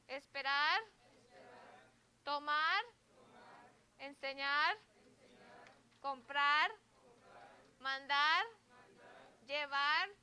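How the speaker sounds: slow, clear words for the class to repeat; teacher drill. Shown as a demonstration. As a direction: model each word with a pause after it.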